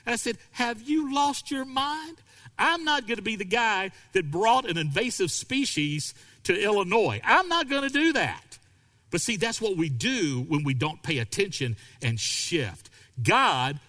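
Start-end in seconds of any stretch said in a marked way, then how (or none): 0.5-8.6 high, incredulous mock outrage; incredulous retort. To the pastor who wants a tree, incredulous retort. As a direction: scoff at the silly idea.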